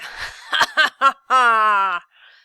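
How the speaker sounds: loud evil cackle of a villain; a triumphant wicked laugh; villainous laugh. A narrator's reading. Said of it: "with a villainous laugh".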